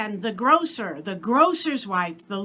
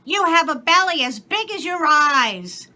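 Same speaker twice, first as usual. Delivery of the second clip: high, loud scolding maid; maid's voice; 6 semitones higher, 7 dB louder than usual. A narrator's reading. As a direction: exclaim like a shocked servant.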